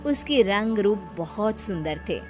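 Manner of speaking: usual speaking voice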